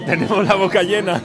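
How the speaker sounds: laughing. Real, not acted. Laughing about talking with mouth full.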